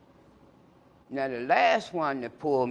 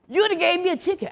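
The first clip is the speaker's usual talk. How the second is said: much higher, louder teasing son; 14 semitones higher, 6 dB louder than usual. Retold or imitated. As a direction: needle your dad. Son jokes at father.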